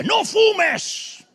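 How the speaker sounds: loud, hammered-out warnings; shouting preacher's list of don'ts; retold shouting preacher. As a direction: bellow each rule like a fiery preacher. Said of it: "él gritaba y decía".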